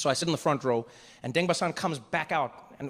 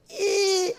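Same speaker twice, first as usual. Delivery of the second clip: short high-pitched squeak; tiny strained "eh" up high; imitated squeezed squeal; 4 dB louder than usual. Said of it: "he'd be like".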